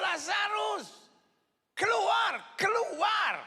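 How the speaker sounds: loud, high commanding call. Retold or imitated. Pastor voicing Jesus calling Lazarus out, loud high shout. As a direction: call the dead man out of the tomb. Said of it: "Tetapi Yesus panggil".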